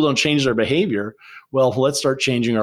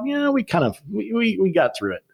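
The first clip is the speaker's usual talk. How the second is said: self-satisfied inner voice; 10 semitones higher than usual. Retold or imitated. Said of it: "but I thought".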